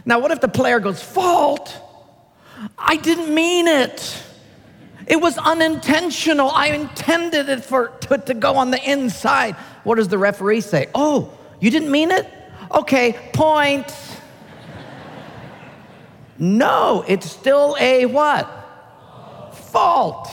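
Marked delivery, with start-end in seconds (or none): 1.0-9.6 high, whiny excuse; protesting player. Acted. Player protesting the fault call. 10.9-13.9 sarcastic referee. Acted. Referee mocking the player's excuse. 19.5-20.3 high, firm referee call. Acted. Referee calling it again.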